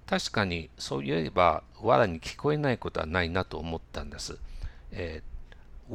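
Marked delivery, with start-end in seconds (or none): none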